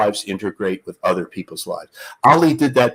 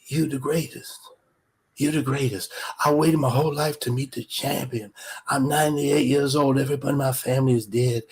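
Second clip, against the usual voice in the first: put-on soft, moved elderly fan; old man; 4 semitones higher, 4 dB quieter than usual. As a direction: pour it out quietly, awed to meet the hero. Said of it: "And the old man looks up".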